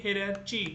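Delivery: "hierarchy" ending in "chee"; common mispronunciation. Shown as a demonstration. Teacher quoting how many people say it, common mispronunciation. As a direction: say "hierarchy" with CH as in cheese. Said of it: "इसे बहुत सारे लोग बोलते हैं".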